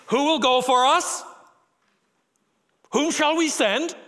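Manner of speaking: high, loud, ringing call to heaven; voice of God. Acted. God calling to the councils of heaven, high and loud.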